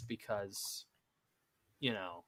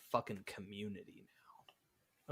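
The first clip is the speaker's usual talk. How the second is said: whispering; 5 semitones lower, 15 dB quieter, slower than usual. Real, not acted. Ranting about the word community.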